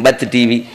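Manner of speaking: బత్తి said with a plain unaspirated b; mock mispronounced బత్తి, no breath on the b; missing aspiration. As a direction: imitate an anchor saying బత్తి for bhakti. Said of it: "ఒత్తులు పలకలేదు".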